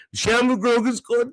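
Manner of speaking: usual speaking voice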